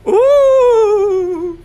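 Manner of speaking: high, loud, eerie ghost wail; ghostly ooh. Acted. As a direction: howl it like a spooky ghost. Ghost appears in the skit.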